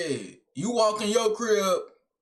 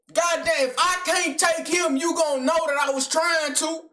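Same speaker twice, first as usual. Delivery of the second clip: indignant outburst; 9 semitones higher than usual. Real, not acted. Viewer scolding Johnny for doing no damage, indignant. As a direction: raise your voice, scolding him in exasperation. Reactor indignant at victim's lack of fight.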